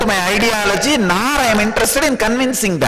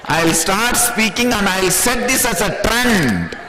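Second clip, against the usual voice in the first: usual speaking voice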